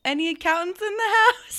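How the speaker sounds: teasing party-MC shout; mock hype man. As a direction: imitate a cheesy crowd warm-up line. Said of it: "who says".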